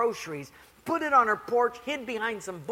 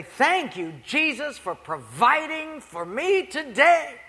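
higher, slow thankful prayer; grateful old lady; 6 semitones higher, 4 dB louder, slower than usual. Retold or imitated. Poor lady thanking Jesus on her porch, slow and high. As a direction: savour each word of thanks.

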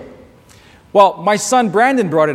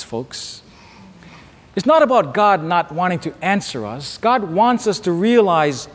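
normal talking voice

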